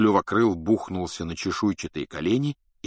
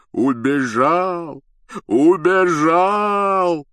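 slow, drawn-out bellow, rising louder; jubilant howling; 8 semitones higher, 7 dB louder, much slower than usual. A narrator's reading. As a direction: howl with overjoyed relief. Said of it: "вопил Хагрид".

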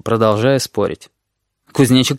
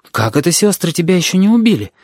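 higher-pitched wondering question; surprised; 6 semitones higher than usual. A narrator's reading. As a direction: ask in mild astonishment. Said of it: "удивился кузнечик".

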